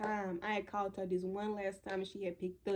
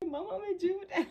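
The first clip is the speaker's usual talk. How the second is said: 1 laughing retell; 11 semitones higher, faster than usual. Retold or imitated. Retelling calling mom scared.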